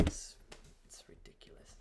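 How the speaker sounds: very quiet, breath-only disbelief; whispered dismay. Real, not acted. Host reacts to the broken stream, whispered dismay. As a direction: let it die to a stunned whisper.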